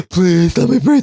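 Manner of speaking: high, breathless little bee voice; tiny pleading bee. Acted. Drowning bee begs mom, high pleading squeak. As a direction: beg for air like a drowning bee.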